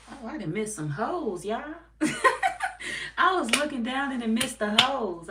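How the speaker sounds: laughing. Real, not acted. Streamer laughing at trolls she missed in chat. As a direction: laugh it out, caught off guard by the chat.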